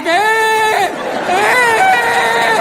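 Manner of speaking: high mock sullen grunts; sulky grunt. Boy posing for Christmas photos, mock sulky grunt. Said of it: "I'm just standing there like".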